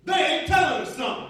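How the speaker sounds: high, loud suspicious lady voice; a loud, high, suspicious passenger; sassy lady. Retold or imitated. Passenger voicing distrust of the crew, loud and high.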